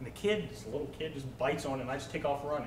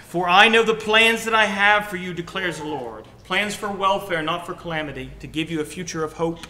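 loud, ringing preaching of the verse; scripture proclaimed; 3 semitones higher, 10 dB louder than usual. Acted. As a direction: proclaim the promise at full force.

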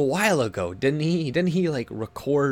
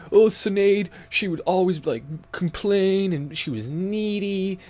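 mocking Andy; 7 semitones higher, 5 dB louder than usual. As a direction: whine it like a sulking crybaby. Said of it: "he's like".

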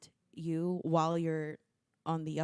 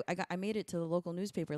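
normal talking voice

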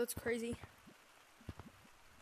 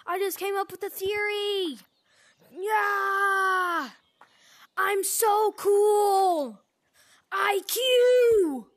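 excited shout; 7 semitones higher, 10 dB louder, much slower than usual. Real, not acted. Thrilled at own theory.

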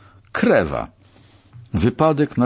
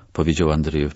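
ordinary delivery, nothing marked

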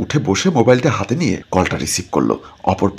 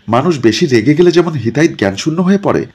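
usual speaking voice

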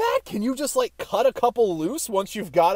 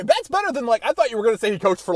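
usual speaking voice

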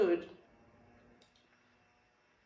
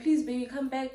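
high-pitched imitation of pleading; mock begging; 7 semitones higher than usual. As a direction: plead like a desperate ex, mockingly. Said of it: "begging me".

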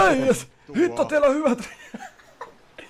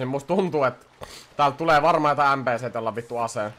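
ordinary talk

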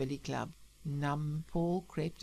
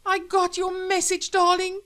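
very high, loud emotional greeting; gushing wife; 13 semitones higher, 10 dB louder than usual. Acted. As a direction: gush to your darling on reunion. Wife rushes to reunite with husband.

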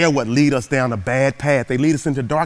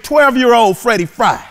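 ordinary talk

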